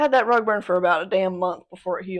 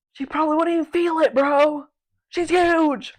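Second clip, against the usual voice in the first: frantic tearful plea; 5 semitones higher, 6 dB louder than usual. Retold or imitated. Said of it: "I started freaking crying".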